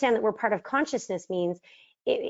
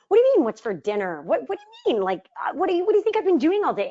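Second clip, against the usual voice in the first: much higher, fast, indignant retort; wife snapping back at her husband's dinner question, high and fast; defensive outburst; 9 semitones higher, 4 dB louder, faster than usual. Retold or imitated. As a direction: fire back defensively as if accused. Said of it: "I would get super defensive".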